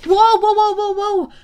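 high, loud string of whoas; a frantic shout to settle a panicking beast; urgent calming shout. Acted. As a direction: call out fast to stop the panic. Hero calming thrashing creature urgently.

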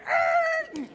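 very high, loud cartoon roar; a panicked roar as it bolts; leopard roar. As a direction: leopard roaring as it runs off. Leopard roars and flees.

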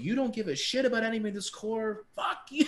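high-pitched mock blow-up, rising to the final curse; retold angry outburst. Member blowing up at staff on finals day, losing it. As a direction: re-enact losing it, voice shooting up high. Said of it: "I lost it".

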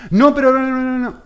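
put-on high, loud whining kid; whiny child protest. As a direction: whine like a spoiled boy refusing. Child protesting father's discipline.

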